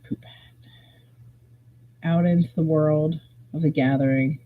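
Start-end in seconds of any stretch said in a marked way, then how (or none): 0.0-0.7 slow conspiratorial whisper. Pretend. Storyteller to viewers about the ruined hat, slow whisper. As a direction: breathe it out slowly like a naughty secret.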